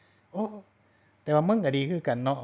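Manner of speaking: high-pitched mock Isan granny; grandmother. Grandma to her grandchild, teasing imitation of grudging approval. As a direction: lightly imitate a grandma conceding a point. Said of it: "ยายก็".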